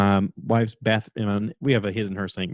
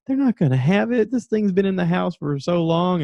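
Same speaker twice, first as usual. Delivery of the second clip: high, scoffing doubt, slower at the end; doubtful husband; 8 semitones higher than usual. Retold or imitated. Husband doubts his wife's advice in a high scoff. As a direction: brush off the idea with a high scoff. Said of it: "And I was like".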